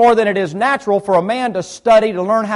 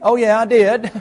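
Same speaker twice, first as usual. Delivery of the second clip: cheeky, higher and laughing; laughing comeback; 3 semitones higher, 3 dB louder than usual. Acted. Preacher teasing the congregation about makeup, laughing. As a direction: toss it back with a grin and a laugh. Preacher answers his own mock reaction.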